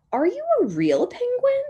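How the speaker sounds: high, loud and quick; squeaky crowd of penguins, challenging; doubting penguins. Acted. Other penguins question Blue Penguin.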